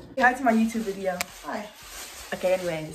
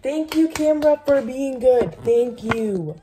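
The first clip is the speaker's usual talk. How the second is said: loud cheery thanks; 5 semitones higher, 6 dB louder, slower than usual. Real, not acted. Loudly praises the camera.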